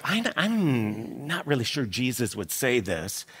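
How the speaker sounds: low, soft hesitant murmur; voicing an uneasy, quiet doubt; doubtful aside. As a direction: say it softly, unsure and reluctant. Voices pastors' doubt about parable.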